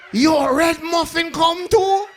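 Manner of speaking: high, slow hyped-up shout; Jamaican gangster shout. Acted. Comic hyping the gangster character in a high slow shout. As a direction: call out like a Jamaican hype man.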